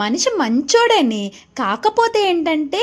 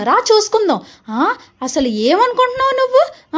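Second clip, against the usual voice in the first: mock challenge; 8 semitones higher than usual. Mock fight challenge to daughter.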